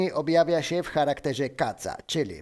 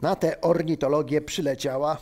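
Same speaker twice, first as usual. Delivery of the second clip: ordinary delivery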